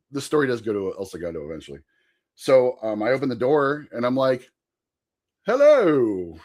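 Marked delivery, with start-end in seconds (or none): 5.4-6.3 higher, louder fake British greeting; put-on British accent. Storyteller greeting roommate's coworker at the door, put-on British accent. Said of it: "have a British accent all weekend".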